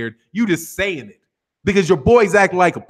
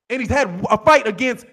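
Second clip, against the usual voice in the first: ordinary talk, nothing marked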